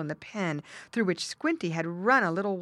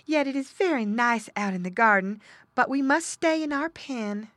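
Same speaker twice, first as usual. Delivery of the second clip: lightly voiced, higher mother pig; resigned mother pig; 7 semitones higher, 5 dB louder than usual. A narrator's reading. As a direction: agree with reluctance. Mother pig gives in wistfully.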